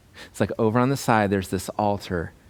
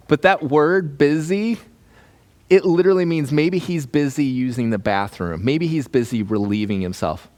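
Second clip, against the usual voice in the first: normal talking voice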